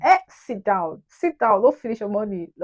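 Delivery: loud, sharp self-scolding; mock scolding. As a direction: tell yourself off loudly. Scolding self about overspending.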